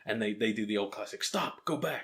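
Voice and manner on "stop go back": a hammed-up Hollywood American "Stop, go back"; mock movie-trailer American, pitched up; bad American accent. Podcast host spoofing the scientists in a bad American accent. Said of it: "that was terrible accent".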